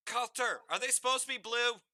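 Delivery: high, loud call across the room; loud announcement. Acted. Fairy girl calling out to the dragonborn across the room. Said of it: "out loud ... announce".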